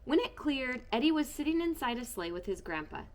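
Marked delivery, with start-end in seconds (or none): none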